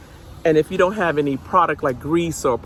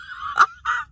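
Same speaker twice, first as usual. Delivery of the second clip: high giggle. Real, not acted. Laughing at dog's attitude.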